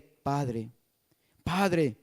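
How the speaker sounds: soft tender prayer to God; tender whispered prayer. Acted. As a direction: hush down to an intimate plea to the Father. Quote of intimate prayer to God.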